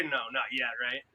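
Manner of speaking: normal talking voice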